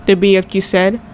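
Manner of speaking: usual speaking voice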